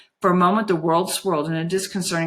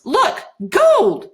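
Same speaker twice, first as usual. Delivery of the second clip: high, loud shout of discovery; miner's excited yell; excited cry; 12 semitones higher, 6 dB louder than usual. A narrator's reading. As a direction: cry out as if striking gold. Miners' cry voiced in narration.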